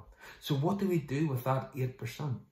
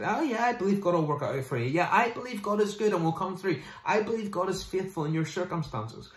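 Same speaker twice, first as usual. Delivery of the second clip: high, quick, glib reassurance; breezy mock believer; 6 semitones higher than usual. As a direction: toss off easy faith for someone else's problem. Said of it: "Belief is fine when it's other people who are risking it".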